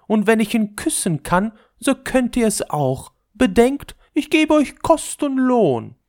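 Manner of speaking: hoch und laut, herrisch; herrische Prinzessin. A narrator's reading. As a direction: befehlen wie eine Kaisertochter. Prinzessin befiehlt den Hofdamen. (hohe, herrische Prinzessin)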